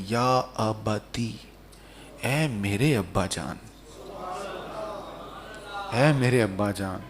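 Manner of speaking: low, soft, slow address to a father; loving and respectful. Acted. Son addresses his dear father, with loving respect. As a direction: reverent tenderness, gently drawn out. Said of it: "بڑے پیار سے کہنے لگے، بڑے ادب سے".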